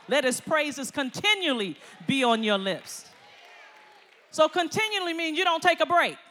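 loud, fired-up preaching; preaching at full force. Acted. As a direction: drive the point home to the congregation. Preaching to the congregation.

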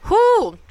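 high breathy whew, letting the emotion out; long whew exhale. Real, not acted. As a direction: blow out a big relieved whew. Exhaling after sad scene.

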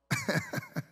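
a short, high burst of laughter; amused squeal of a laugh. Real, not acted. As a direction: laugh at your own joke. Preacher laughs at own joke.

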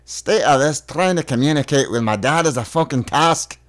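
put-on Scottish-accented grumble. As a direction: grumble it like a Scot. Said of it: "You're so from Scotland".

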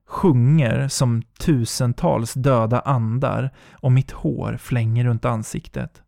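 ordinary delivery, nothing marked

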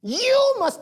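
high, loud, hitting YOUR; preacher's shout on your money. Acted. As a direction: call it out, stressing your.

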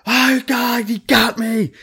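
very high, loud mock-anguished wail; imitated scream. Pranked coworker behind a closed door, imitated screaming. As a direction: cry out as the prank's victim, hammed-up. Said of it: "Like, just screaming it".

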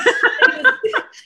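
high loud laugh; burst of laughter. Real, not acted. Laughing hard at her own story of the audition. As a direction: let out a big laugh.

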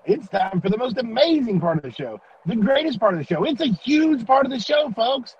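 booming high game show host; announcer voice. Pretend. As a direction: hype it like a ringmaster. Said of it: "I'd let you show us the right way to".

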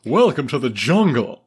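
loud put-on comic voice; a hammy movie impression slipping off target; bad Cameron Fry impression. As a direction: belt it out in a silly character voice. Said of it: "slowly turning into a really bad Rodney Dangerfield".

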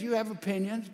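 ordinary delivery

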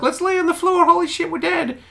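high, squealing mock panic. Quoting panicked elevator riders, squealing mock panic. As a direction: play the freaking-out crowd.

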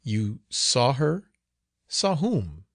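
slow, incredulous questions, lifting high; puzzled, startled woman; astonished questioning. A narrator's reading. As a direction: ask it in disbelief. Astonished woman questions frightened girl.

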